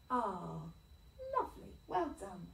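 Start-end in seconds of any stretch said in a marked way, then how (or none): none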